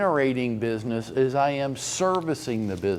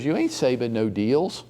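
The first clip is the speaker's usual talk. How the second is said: ordinary talk, nothing marked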